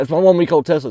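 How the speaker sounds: mock-indignant repeat of "one-week-old Tesla"; insistent complaining owner. Tesla owner harping on his new car, loud mock indignation. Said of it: "he kept just dragging that".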